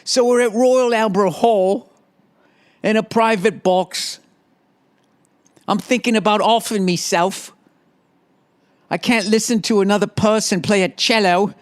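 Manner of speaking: comic storytelling in a fake English accent. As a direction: put on a silly English voice. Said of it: "hearing that stupid accent".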